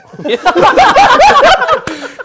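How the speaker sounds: loud high laugh bursting out; big burst of laughter. Real, not acted. Guest laughing loudly at his own story.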